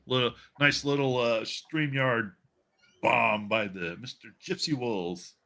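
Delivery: ordinary talk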